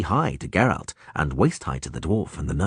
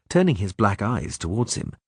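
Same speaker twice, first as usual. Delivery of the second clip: usual speaking voice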